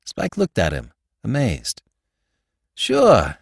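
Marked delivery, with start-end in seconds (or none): 2.7-3.4 higher, uncertain agreement; puzzled assent, pitched up. A narrator's reading. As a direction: agree while baffled. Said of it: "he said, puzzled".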